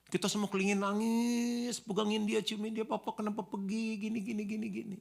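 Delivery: high, sustained mock wail; high-pitched imitation of tearful pleading; mock weeping mourners. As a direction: wail it out like grieving relatives, hammed-up. Imitating mourners wailing at coffin.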